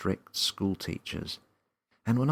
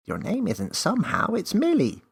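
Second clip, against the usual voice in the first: high, louder teasing elf; cheeky elf; 8 dB louder than usual. A narrator's reading. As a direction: tease her knowingly. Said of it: "the cheeky elf said".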